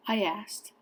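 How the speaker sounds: usual speaking voice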